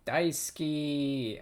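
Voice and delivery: very slow "Daisuki" sounded out; slow learner reading; slow drawn-out. Shown as a demonstration. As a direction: stretch "Daisuki" carefully. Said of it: "drawn-out practice".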